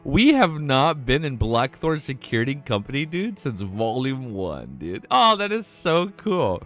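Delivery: excited. Real, not acted. Excited return to old location.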